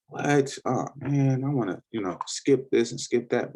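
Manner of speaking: high, whiny beginner begging to skip ahead; mock impatient beginner. Trader mocking a new student, high and whiny. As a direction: pitch it up like an eager newbie who wants to skip the basics. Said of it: "preschool, you're like".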